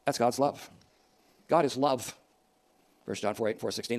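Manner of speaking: usual speaking voice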